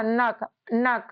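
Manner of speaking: नक drawn out very slowly twice; slow syllable. Shown as a demonstration. Teacher slowly sounding out नक for pupils. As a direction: stretch नक so each sound shows. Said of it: "एन ए के".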